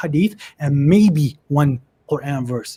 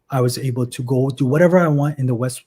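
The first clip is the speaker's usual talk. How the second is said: ordinary delivery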